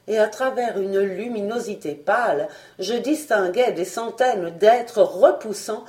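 usual speaking voice

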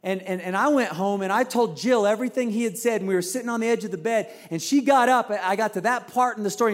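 ordinary talk, nothing marked

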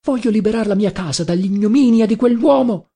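tearful protest. A narrator's reading. Adriana wants her house freed, tearful protest. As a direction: insist in mild tearful distress. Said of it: "protestare piangendo la povera Adriana".

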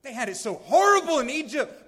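high, loud mock whine; whining complaint. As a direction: whine about Egypt like the grumbling people. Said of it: "they whined and complained and said".

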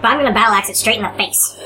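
ordinary talk, nothing marked

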